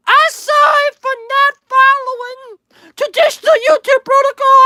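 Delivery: high, put-on pleading voice, hammed-up; mock groveling voice. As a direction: beg the master in a silly high voice. Reads and answers in put-on voice.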